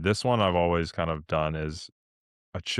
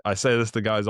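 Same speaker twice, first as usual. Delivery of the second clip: ordinary talk, nothing marked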